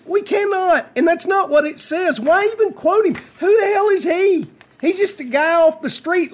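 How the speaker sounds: exasperated, high-pitched rant; indignant rebuttal. Real, not acted. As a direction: fire back in high-pitched disbelief. Host rebuts activist he quoted.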